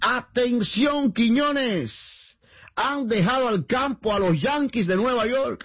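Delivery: high, slow, drawn-out mock bulletin; teasing announcer's alert; breaking-news call. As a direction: stretch every word like a grand urgent announcement. Announces breaking news to needle co-host.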